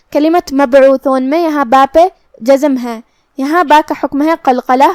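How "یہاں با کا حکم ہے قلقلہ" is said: ordinary delivery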